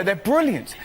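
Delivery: ordinary talk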